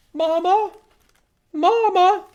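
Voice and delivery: put-on high, loud repeated baby calls; gosling cries. Abandoned goslings calling after the bear. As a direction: call after him like lost chicks.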